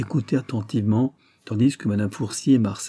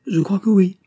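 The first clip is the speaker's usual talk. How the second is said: higher, louder, mildly defensive; pressed, unsure answer; 10 semitones higher, 7 dB louder than usual. Acted. Wife pressed by her husband about the desk, insisting uncertainly. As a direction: lift the voice insistently under questioning.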